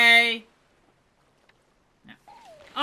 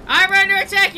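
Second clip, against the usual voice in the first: louder, higher cry with under pushed; louder alarmed call; 6 semitones higher, 8 dB louder than usual. Real, not acted. Pirate player calling crewmate under attack, louder. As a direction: yell it again, more urgent.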